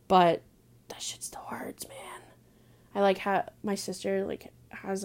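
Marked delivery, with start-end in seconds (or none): 0.9-2.2 whispering, still hurting; soft sad whisper; hushed sad whisper. Real, not acted. Grief over dog, whispered.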